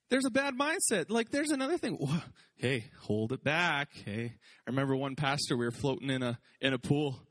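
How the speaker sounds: ordinary talk, nothing marked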